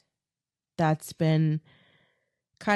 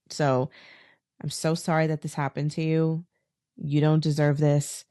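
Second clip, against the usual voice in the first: ordinary delivery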